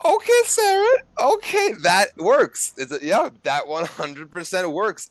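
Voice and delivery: high, loud burst of approval; loud, high-pitched delight; impressed excitement. Real, not acted. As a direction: exclaim it, thrilled by the clever move. Game master delighted by player's rescue.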